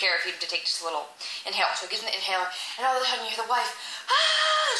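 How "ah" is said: very high, sustained scream; mock scream. Wife attacked in the store, high mock scream. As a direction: let out an imitated high shriek.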